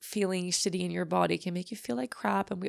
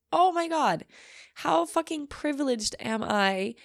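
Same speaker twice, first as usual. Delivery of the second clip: exclaiming; 8 semitones higher, 3 dB louder than usual. Real, not acted. Exclaims in disbelief.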